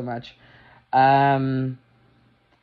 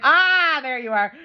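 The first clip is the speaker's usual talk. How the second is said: pleased recognition; 11 semitones higher, 3 dB louder than usual. Retold or imitated. Reacting to Worf's vow.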